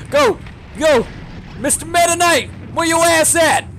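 high, loud calling-out; shouted challenge. Pretend. Gamer calling out Meta Knight, shouted challenge. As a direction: holler for your rival to show himself.

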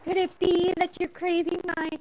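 high seductive mock voice; quoting a TikTok skit in a high teasing voice; TikTok voice quote. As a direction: tease like the TikTok character. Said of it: "there's this one thing".

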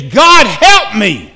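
slow, loud, drawn-out cry for help; serious plea. Acted. David asking God in desperate need, serious plea. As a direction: cry out earnestly. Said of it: "I am serious".

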